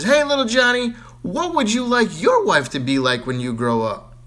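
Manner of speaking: high, loud teacher call. Acted. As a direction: wake him up sharply. Teacher calling sleeping Johnny.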